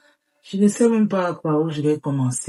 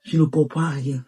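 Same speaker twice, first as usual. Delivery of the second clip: ordinary talk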